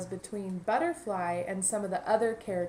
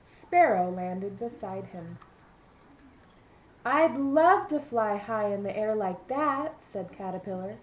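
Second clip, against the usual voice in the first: lively picture-book narration; storybook voice for young listeners; reading to children; 4 dB louder than usual. A narrator's reading. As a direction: read it aloud to a class of kids.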